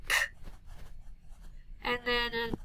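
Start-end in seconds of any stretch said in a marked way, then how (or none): 0.1-0.2 "K" as a bare k sound, no vowel; "K" as a short voiceless phonics click; letter sound. Shown as a demonstration. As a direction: make just the first sound of cat for "K". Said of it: "if it starts with a".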